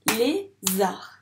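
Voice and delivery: lézard in two beats, lé, zard; lézard with a gap between syllables; split into syllables. Shown as a demonstration. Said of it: "On vérifie".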